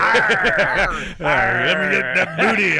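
growling pirate, loud and playful; hammed-up pirate growl, shouting for booty. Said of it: "I'm a pirate insider".

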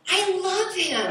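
slow, smitten, with a laugh; dreamy love. Retold or imitated. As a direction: melt as you say it. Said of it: "now I'm like".

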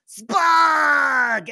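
high gleeful goblin yell; excited goblin shout. Acted. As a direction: shout his name like a happy goblin. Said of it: "he goes".

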